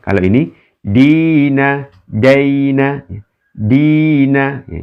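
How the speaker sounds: "dina" drawn out long, "daina" short; long vowel versus lin. Shown as a demonstration. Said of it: "ini di ayun ya, karena matobi".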